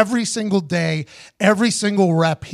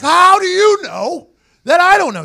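high, slow, loud and incredulous; exasperated demand; 12 semitones higher, 8 dB louder than usual. Retold or imitated. Demanding answers from teammates, slow incredulous high demand. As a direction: spell out the outrage slowly and loud.

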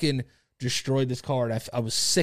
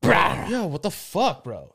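retold shocked outburst; 7 semitones higher, 5 dB louder, slower than usual. Retelling own reaction to crash.

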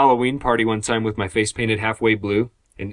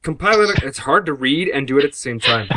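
higher-pitched put-on Scottish accent; 4 semitones higher, 3 dB louder than usual. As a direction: read it as a hammed-up Scot. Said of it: "epilogue in a Scottish accent".

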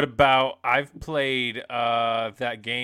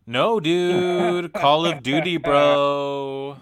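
loud, high dude-bro voice; Derek voice; 4 semitones higher, 10 dB louder, much slower than usual. Acted. Friend teased about World of Warcraft protests, in a loud Derek voice. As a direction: belt it out and stretch the final word. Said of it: "You just did that in your Derek voice".

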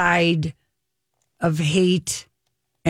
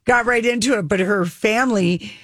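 usual speaking voice

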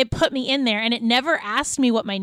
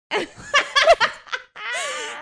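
high laugh; 12 semitones higher than usual. Real, not acted. Laughs at herself.

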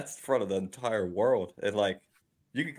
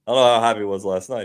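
ordinary talk, nothing marked

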